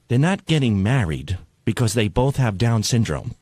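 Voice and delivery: low, deadpan retold correction; mock grave rebuke. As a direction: imitate a lady soberly setting someone straight. Retold rebuke from the offended lady.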